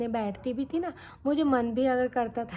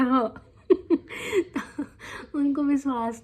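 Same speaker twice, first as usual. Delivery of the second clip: laughing; 4 semitones higher, much slower than usual. Real, not acted. Admitting lisp, laughing.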